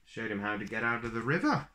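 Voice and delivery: plain reading voice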